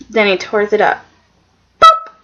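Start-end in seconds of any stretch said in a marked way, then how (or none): 1.8-2.1 a sudden high, loud "boop" for paper tearing; mock tearing noise. Telling the show's scene, imitating the tear with a high loud boop. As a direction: pop it out like a cartoon rip. Vocal sound as design torn.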